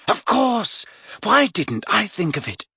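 high-pitched dawning realisation; stunned. A narrator's reading. Girl kicks herself for missing the idea, amazed. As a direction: exclaim as the obvious answer lands. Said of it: "sounding stunned".

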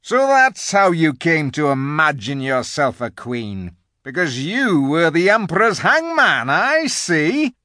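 loud, indignant beaver; Mister Beaver. A narrator's reading. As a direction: jeer at the queen, loud and high. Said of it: "said Mister Beaver".

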